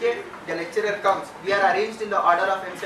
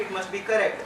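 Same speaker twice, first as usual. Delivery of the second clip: ordinary talk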